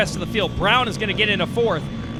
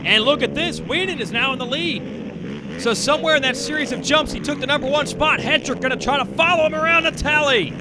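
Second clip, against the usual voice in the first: excited, higher play-by-play; motorsport commentator; 6 semitones higher than usual. Acted. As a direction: call the lead change with excitement. Live race call, pitch up.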